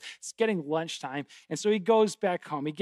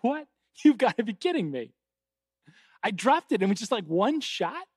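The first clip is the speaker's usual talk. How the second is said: higher-pitched mock panic; panicked disbelief; 6 semitones higher, 6 dB louder than usual. Johnny reacting to killing the duck with one stone, panicked disbelief. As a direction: blurt it out in startled disbelief. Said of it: "Johnny panics".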